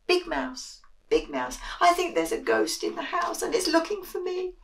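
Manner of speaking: high scared little mouse crying out; mildly panicked little mouse; frightened. Acted. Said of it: "feeling a bit frightened, he cried".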